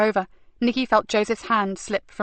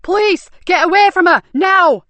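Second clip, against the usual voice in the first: much higher and louder, a commanding bellow; loud police shout; 10 semitones higher, 9 dB louder than usual. Acted. An officer shouts at the thugs from afar, loud. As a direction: stop them dead from across the car park. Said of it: "a loud shout echo".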